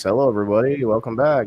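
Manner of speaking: loud, singsong mock announcer voice; announcer guy. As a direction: greet the crowd like a hammed-up show announcer. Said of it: "like, an announcer guy voice".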